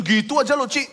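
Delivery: high, loud mock brush-off; dismissive friend. Friend belittles the mourner, high loud mock dismissal. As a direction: imitate a tactless belittler. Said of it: "dari sisi orang tersebut yang digituin".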